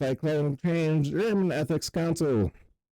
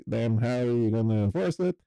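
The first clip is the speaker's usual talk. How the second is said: ordinary delivery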